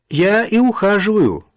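loud, high-pitched call. A narrator's reading. Hidden old man answers from the bushes, calling out. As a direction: pipe up loudly from a distance. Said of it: "раздалось из кустов".

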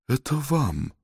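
soft, sheepish offer; embarrassed. Acted. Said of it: "конфузясь".

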